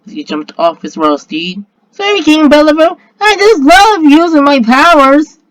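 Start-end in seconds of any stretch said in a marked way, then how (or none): 1.9-5.4 put-on gleaming child. A narrator's reading. Gleaming child apologizes to the king, high loud child voice. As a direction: pipe up high and loud, stretching the words. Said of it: "said the gleaming child".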